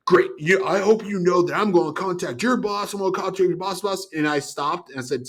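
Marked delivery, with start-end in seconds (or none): 0.1-4.1 higher mock-angry threat; irate threat. Angry customer threatening to call the boss, mock fury. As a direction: imitate a furious customer threatening. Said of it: "Guy's irate".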